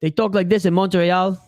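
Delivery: imitating a Montreal accent; joking impression of Montreal speech. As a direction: put on a mock Montreal accent. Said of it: "If you go to Montreal".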